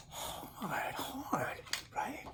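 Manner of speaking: breathy whispered hard, hard; whispered aside to the listeners. Real, not acted. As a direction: whisper it like a sad secret. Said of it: "whispered aside".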